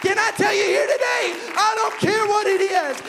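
loud, high fervent preaching; preaching at full force. Acted. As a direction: preach it out to the crowd. Preaching climax.

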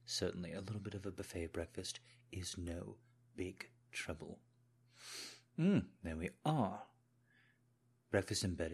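deferential British butler tending the mistress; British accent. Pretend. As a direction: serve breakfast in a polite British accent. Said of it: "put on a British accent".

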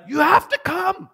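high, slow, drawn-out insistence; acted-out pleading order from a friend; insistent urging. Friend insists he must come.

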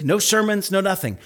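ordinary talk, nothing marked

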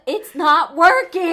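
put-on high, loud, slow kid whine; drawn-out child's whine; childish whine. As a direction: complain like a frustrated little kid. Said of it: "she kinda sounds like, like, my child".

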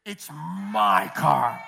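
lightly voiced father, low and slow; a hint of a stern dad settling it; father's final word. Dad telling his teenage son who owns the car, low slow firm.

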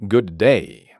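normal talking voice

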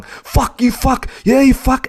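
high, sneering taunt thrown at a passing cyclist; mock aggressive taunting. Muscly guy taunts narrator on bike, mock jeering. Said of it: "just comes out at me and starts going".

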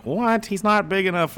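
high-pitched whiny objection; mock complaining. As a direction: mimic a scandalized fan. Mimics complaining fans.

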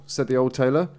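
ordinary delivery, nothing marked

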